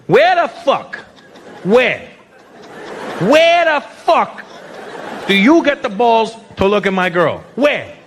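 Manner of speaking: high, mock-furious interrogation; hammed-up indignant boyfriend confronting a rival; angry questioning. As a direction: demand an answer, pausing between attempts. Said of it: "this is what they do is when they get angry".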